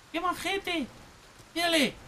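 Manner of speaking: high, doting pet-talk; tender high-pitched fondness; affectionate cooing. Real, not acted. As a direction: coo over a sweet dog, voice way up. Cooing over the friendly dog.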